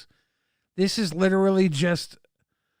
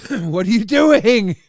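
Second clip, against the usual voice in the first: laughing disbelief; 5 semitones higher, 9 dB louder than usual. Real, not acted. Laughing disbelief at a chat message.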